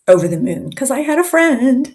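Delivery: put-on remembered childhood thrill, bursting out; delighted. Recalling joy at first friend.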